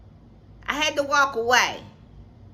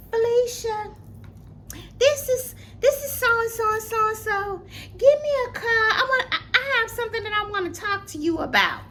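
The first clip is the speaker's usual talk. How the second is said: much higher, sing-song fake-friendly voice; imitating the woman's voicemail; 10 semitones higher than usual. The bully's voicemail, mocked in a high fake-sweet voice. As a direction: mimic a falsely sweet caller. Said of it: "she said".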